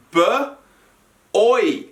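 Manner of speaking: b, then oi, split by a long pause; sounds split apart. Shown as a demonstration. Teacher sounding out boy for pupils, sound by sound. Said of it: "the sounds of boy are".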